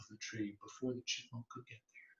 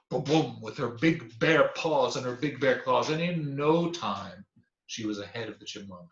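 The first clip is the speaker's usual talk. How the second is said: ordinary delivery, nothing marked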